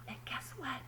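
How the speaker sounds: put-on secretive whisper to the kids. A narrator's reading. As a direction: lean in and whisper the tease. Teasing the children with suspense.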